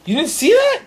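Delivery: loud, high, amazed yell; excited shout. Real, not acted. Teen shouts to friend after the flame flares. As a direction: call out what just happened.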